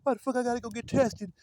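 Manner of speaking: mock hollering protest. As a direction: imitate someone hollering in protest. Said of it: "they go to hooping and hollering".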